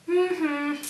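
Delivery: high two-note hum, imitating the girl; sustained high hum; hummed call. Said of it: "copy me".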